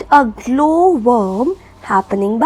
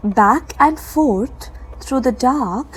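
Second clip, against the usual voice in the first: ordinary talk, nothing marked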